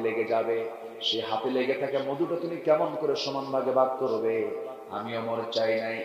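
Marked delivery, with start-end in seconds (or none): none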